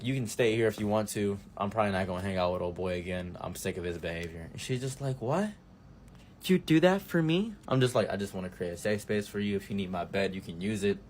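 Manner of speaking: gentle, soothing offer of shelter. Retold or imitated. Comforting the girl.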